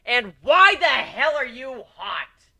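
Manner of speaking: high, loud, slow exasperation; indignant outburst. Acted. Narrator jealously demanding why Aoi looks so good. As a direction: drag it out in disbelief.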